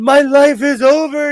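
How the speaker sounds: high, loud melodramatic lament; mock crying wail. Dad imitating son's big crying over lost data, mock wail. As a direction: wail it like a distraught teen, hammed up. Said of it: "he was big crying".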